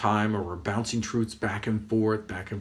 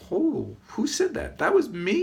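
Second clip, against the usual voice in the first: high-pitched, playful astonishment; mock amazement; 11 semitones higher than usual. Guru amazed at own wise words, mock amazement. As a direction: marvel at yourself in a high voice.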